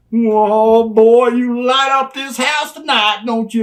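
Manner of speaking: high, loud, slowed gush over a star; gushing greeting. Retold or imitated. Manager gushing over Steve after the match, loud and high. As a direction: fawn over him loudly and slowly.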